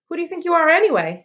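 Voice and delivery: loud, high, fast challenge; reckless challenge. A narrator's reading. Ware challenging the girl from the rim, loud and fast. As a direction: throw it up at her from above. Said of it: "his reckless self challenged".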